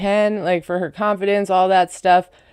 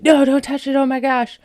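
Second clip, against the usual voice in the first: higher-pitched retold alarm; mock panicked protest; 5 semitones higher than usual. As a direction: act out fussing over being touched. Retold panic over hat removal.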